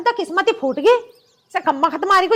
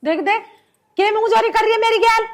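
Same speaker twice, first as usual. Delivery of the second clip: usual speaking voice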